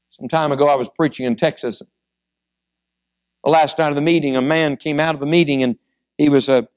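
ordinary talk, nothing marked

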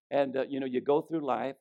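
ordinary talk

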